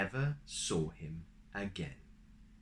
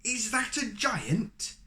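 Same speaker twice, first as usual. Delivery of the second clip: high, slow alarmed mother; mother gasps slowly, high and alarmed; 12 semitones higher, 4 dB louder, slower than usual. Acted. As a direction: dread in her voice.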